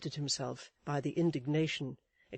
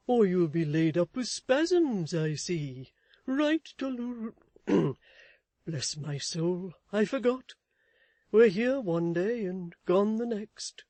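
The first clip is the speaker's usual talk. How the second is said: raised, fussy husband voice; Mister Chick's voice; 4 semitones higher, 7 dB louder than usual. A narrator's reading. Husband fussing at his wife, in a raised fussy voice. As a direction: fuss over his wife anxiously.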